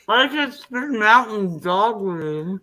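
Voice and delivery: slow, mouth crammed with marshmallows; cheeks stuffed full. Real, not acted. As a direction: talk around a full mouth. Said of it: "You're freaking choking on Smurfs".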